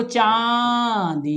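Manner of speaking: चांदी with the चा drawn out slowly through the nose; teacher demonstrating the nasal sound in चांदी; nasal vowel demo. Shown as a demonstration. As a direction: stretch चांदी, nasalising the first syllable. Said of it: "अब नाक से बोलेंगे चा को".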